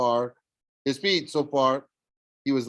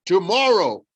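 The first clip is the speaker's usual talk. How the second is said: impatient cyclops; 7 semitones higher, 8 dB louder than usual. A narrator's reading. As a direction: lay it down like a giant.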